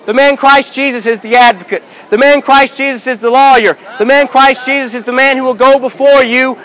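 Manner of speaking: usual speaking voice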